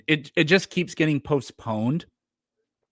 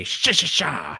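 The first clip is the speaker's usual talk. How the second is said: Dale impression; 4 semitones higher, slower than usual. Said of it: "the only Dale thing I do is I say".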